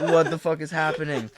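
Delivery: laughing through the panicked line; half laughing at the memory; laughing retelling. Retold or imitated. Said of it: "and I'm like".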